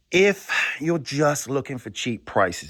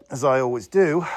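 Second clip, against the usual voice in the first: ordinary delivery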